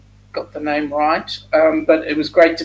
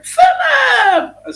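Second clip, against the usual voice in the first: a high, loud laughing call of the name; excited shout; 7 dB louder than usual. Retold or imitated. Retelling Ilana calling out the name.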